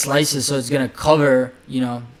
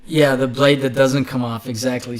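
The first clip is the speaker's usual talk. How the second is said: usual speaking voice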